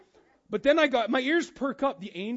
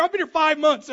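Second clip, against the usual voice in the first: normal talking voice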